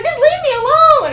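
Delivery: high, loud upset plea; upset leave me alone. Retold or imitated. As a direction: push him away with your voice. Telling the officer off.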